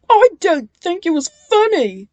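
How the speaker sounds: high, loud annoyed snap; snapping. A narrator's reading. Bill annoyed at the prank, snapping. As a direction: snap back sulkily. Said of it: "snapped Bill".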